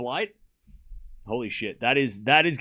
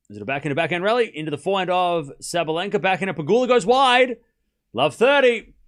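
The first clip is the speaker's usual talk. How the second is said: fast, rising, louder live call; tennis play-by-play; 5 semitones higher, 5 dB louder than usual. Acted. Streamer calling a rally for chat, building to a loud score call. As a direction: build excitement as the rally ends.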